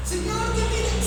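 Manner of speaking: high, loud begging call; anguished believer begging God; pleading complaint. Pretend. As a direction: call out again to a silent God. Believer pleading again, God silent.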